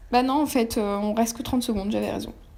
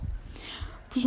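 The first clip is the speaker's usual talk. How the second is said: chuchoté; 10 dB quieter than usual. Real, not acted. Aparté bref.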